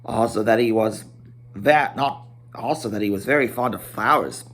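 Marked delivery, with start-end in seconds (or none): none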